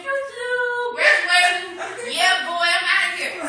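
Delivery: high sing-song taunt of a breezy classmate; mocking sing-song. To the church, mimicking the classmate strolling out, sing-song. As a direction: tease his carefree exit.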